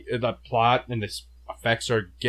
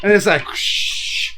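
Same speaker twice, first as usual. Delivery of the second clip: loud whoosh effect; 8 semitones higher, 7 dB louder, slower than usual. Retold or imitated. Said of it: "I got some effects in here".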